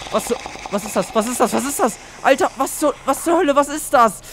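real alarm, escalating; rising panic. Real, not acted. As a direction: build from startled to shouting panic. Sudden scare in horror game.

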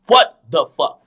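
loud, punchy disbelief; loud retold reaction to something mind-blowing; retold astonishment. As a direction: exclaim it loudly, recalling your shock. Retells stunned reaction to vivid dream.

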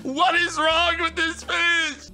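high, loud, drawn-out wail; frustrated yelling. Real, not acted. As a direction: cry out in dismay at losing it. Fish escapes into rocks.

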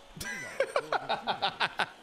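high, stuttering giggle; drawn-out laugh. Real, not acted. Streamer amused by his angry-face emotes, high giggling laugh. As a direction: laugh in a long high rattle.

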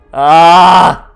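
loud frustrated yell; frustrated scream. Real, not acted. At the game, a loud frustrated scream. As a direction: let out a fed-up scream.

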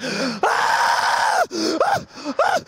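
hammed-up frenzied screams; berserk yells. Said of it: "she goes fucking berserk".

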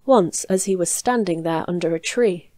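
ordinary delivery, nothing marked

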